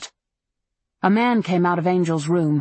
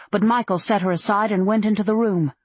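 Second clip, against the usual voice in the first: usual speaking voice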